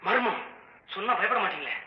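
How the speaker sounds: creeping campfire horror voice; hushed suspense tale. Acted. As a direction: pull him into the dark, step by step. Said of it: "ஒரு suspense கதை, மர்மம்".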